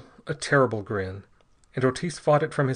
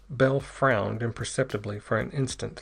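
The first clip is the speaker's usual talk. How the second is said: usual speaking voice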